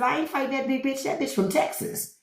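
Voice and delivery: high, fast, hushed backing out; lightly hammed-up panic about the big Texan; mock scared refusal. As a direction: squeak out of the fight. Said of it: "I said".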